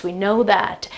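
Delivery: ordinary delivery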